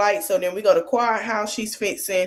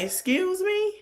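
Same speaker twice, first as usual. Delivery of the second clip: much higher, incredulous rising question; indignant attitude; 13 semitones higher, 4 dB quieter, slower than usual. Retold or imitated. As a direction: mimic someone taken aback, pitched way up. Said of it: "looking at him with attitude".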